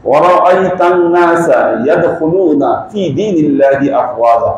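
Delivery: ইয়াদখুলুনা said cleanly with its own ইয়া sound, slowly; correct recitation. Shown as a demonstration. Teacher showing the congregation the right reading, slowly. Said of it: "এটা right".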